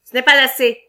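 loud insistent boss; stubborn boss shouting him down; insisting. Acted. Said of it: "insista le patron".

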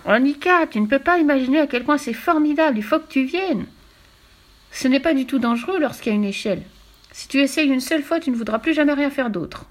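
high-pitched, enthusiastic little brother voice; excited coaxing. A narrator's reading. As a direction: urge your sister to come and see. Tommy excitedly coaxing his sister. (voix aiguë, enthousiaste et persuasive)

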